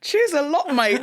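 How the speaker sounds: high laughing Cockney cheers a lot mate; chirpy Cockney, giggling through it; Cockney thanks. Retold or imitated. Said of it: "Like you said".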